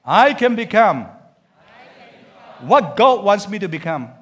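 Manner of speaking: strong conviction, every word weighted. Shown as a demonstration. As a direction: proclaim it like you mean it. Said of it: "Say it with more conviction".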